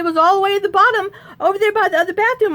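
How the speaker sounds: fast, high-pitched excuse; worked-up retold protest; defensive protest. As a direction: rattle off a flustered excuse, high and quick. Defensive answer in quarrel.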